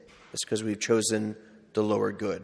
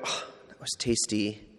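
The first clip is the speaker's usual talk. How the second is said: savoring; 2 semitones lower, 8 dB quieter than usual. Retold or imitated. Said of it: "you eat that chocolate chip cookie and you go".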